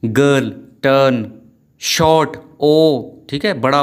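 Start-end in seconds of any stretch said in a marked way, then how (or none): 0.0-2.4 "गर्ल, टर्न, शॉर्ट", each vowel held; long vowels. Shown as a demonstration. Teacher asking for close attention, stretched "गर्ल, टर्न, शॉर्ट". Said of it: "ध्यान से समझिए इनको".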